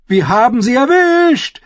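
high-pitched triumphant shout; excited calling out. A narrator's reading. Young defender cheering a hit, calling out. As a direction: crow it out over the battle. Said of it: "rief Satin".

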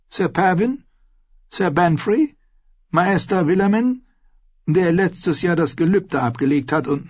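high, chummy maester voice; cheerful old scholar, reassuring her; jolly fat maester. Acted. Maester zählt Kinder auf. (hohe, gemütliche Maester-Stimme)

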